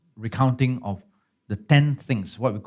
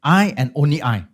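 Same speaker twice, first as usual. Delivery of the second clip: "I and only I" said louder, each I stressed; emphatic I; 4 semitones higher, 6 dB louder than usual. Shown as a demonstration. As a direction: lean hard on "I and only I". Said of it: "Anochi is an emphatic".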